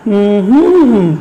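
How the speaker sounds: closed-mouth hum that rises and falls; hummed stress pattern. Shown as a demonstration. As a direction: hum of a word's rhythm, swelling on the middle beat. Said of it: "আমার সাথে করতে পারো এভাবে?".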